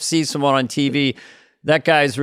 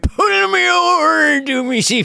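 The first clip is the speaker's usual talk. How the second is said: hammed-up higher-pitched character voice; Robert Shaw; 5 semitones higher than usual. Comic plays the Jaws actor having a heart attack, hammed-up impression. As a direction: do a broad mock impression of the Jaws captain. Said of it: "Yeah, Robert Shaw".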